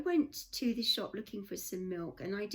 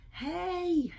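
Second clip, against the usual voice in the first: high, loud friendly call of "Hey!"; mock friendly driver greeting; friendly American hey; 10 semitones higher, 5 dB louder than usual. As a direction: throw out a bright friendly hey like a passing driver. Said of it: "he was, like, leaning across".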